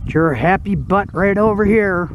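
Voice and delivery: usual speaking voice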